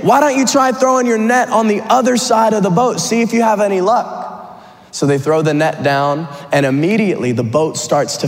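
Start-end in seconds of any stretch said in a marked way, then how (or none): 0.0-4.3 higher-pitched hail; calling back out. Acted. Voice from the beach advising fishermen in the boat, calling out. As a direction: call a suggestion across the water. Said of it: "the voice calls back out to them".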